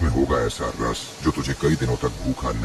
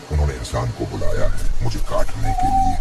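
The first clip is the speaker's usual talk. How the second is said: rising alarm, louder and higher; urgent warning; 4 semitones higher, 8 dB louder than usual. Acted. Magic tree warns Rano the man will cut it, urgent loud warning. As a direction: sound the alarm to the little bird.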